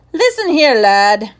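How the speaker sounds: looming headmaster, loud and high, menacing; fearsome tone. Acted. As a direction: bear down on the boy with a fearsome bark. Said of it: "he said in a fearsome tone".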